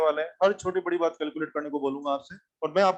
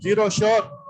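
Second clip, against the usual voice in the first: challenging call-out; 2 semitones higher, 5 dB louder than usual. Real, not acted. Teacher challenging a wrong answer.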